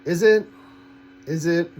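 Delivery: high, loud, doubting "is it?"; incredulous doubt. Retold or imitated. Doubting fans excited about the trailer, incredulous high loud. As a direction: scoff at the idea, voice jumping up. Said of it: "I'm like".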